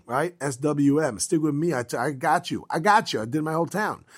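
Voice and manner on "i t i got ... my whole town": normal talking voice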